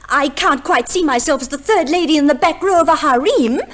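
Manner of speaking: usual speaking voice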